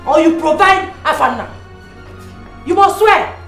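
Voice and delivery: shrill mother insisting on an oath; furious demand. Acted. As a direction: keep hammering him to swear.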